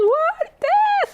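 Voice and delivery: high, startled what; imitating a stunned mother; mom's shocked cry. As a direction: mom hearing bad news, shocked yelp. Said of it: "She says".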